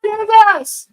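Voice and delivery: high loud cheer to attack; egging on. Real, not acted. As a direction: hype them into the kill.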